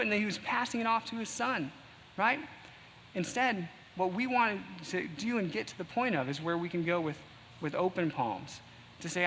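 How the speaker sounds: usual speaking voice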